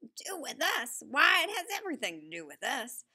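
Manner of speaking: excited grandpa near a shout; almost shouting. A narrator's reading. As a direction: nearly burst out shouting with joy. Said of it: "and almost shouted".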